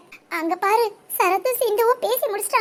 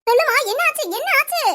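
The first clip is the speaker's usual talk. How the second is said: high, anxious pleading voice; acted worry; 8 semitones higher than usual. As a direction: play the worried friend asking what happened. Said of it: "இதுவும் நடிப்பா?". (நடிப்பு கவலை)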